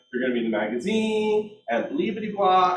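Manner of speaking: mock salesperson reeling off features; rote sales pitch. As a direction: imitate an over-rehearsed listing presentation. Mimics own rote listing presentation.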